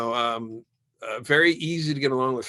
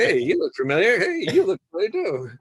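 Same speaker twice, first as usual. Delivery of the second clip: lightly voiced curious locals, pitched up; a hint of excited Dubliners recognizing kin; imitating Irish locals; 7 semitones higher, 5 dB louder than usual. Telling a friend how Dublin strangers greeted him, lightly mimicked and pitched up.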